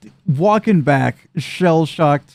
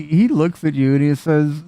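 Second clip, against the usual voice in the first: ordinary delivery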